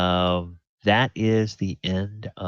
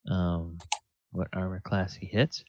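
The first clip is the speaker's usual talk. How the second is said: ordinary delivery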